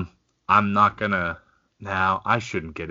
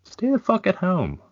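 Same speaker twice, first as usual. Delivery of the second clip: much higher, sing-song repeat; high-pitched echo; 13 semitones higher than usual. Real, not acted.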